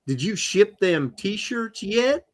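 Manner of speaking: put-on Southern accent. Host imitating Katie's way of talking, Southern accent. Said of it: "that was my Southern accent".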